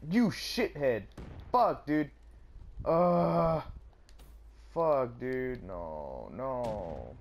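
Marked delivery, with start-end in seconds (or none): 0.1-5.6 loud, higher furious outburst; real rage at a cheap death; angry yell. Real, not acted. Gamer cursing the enemy who got him, angry yell.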